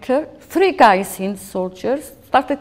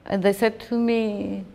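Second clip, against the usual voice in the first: ordinary delivery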